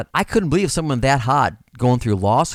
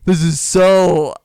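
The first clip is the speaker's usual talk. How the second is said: excited; 5 semitones higher, 5 dB louder, slower than usual. Retold or imitated. Peeking at the client, amazed.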